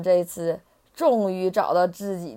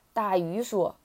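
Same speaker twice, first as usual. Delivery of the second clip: ordinary delivery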